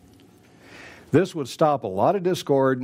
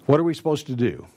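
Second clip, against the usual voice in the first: normal talking voice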